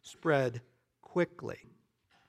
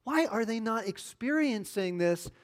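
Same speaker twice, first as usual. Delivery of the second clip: mock frustrated whine; 6 semitones higher than usual. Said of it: "you're like".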